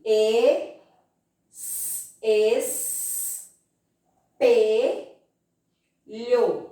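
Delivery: "E, s-, es-, pe-, lho" with each sound held and isolated; sounding out letters. Shown as a demonstration. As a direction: draw out each piece, pausing between, the "s-" a bare hiss. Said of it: "Eu ouço o som da letrinha E".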